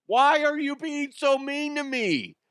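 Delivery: very high, drawn-out wail; whiny mock protest. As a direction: whine it slowly like a wounded victim. Whiny put-on protest at being insulted.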